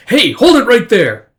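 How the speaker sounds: loud, high, slow mock-guard shout; shouted order. Guard stopping a man dashing outside, shouted order. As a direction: call after someone running off.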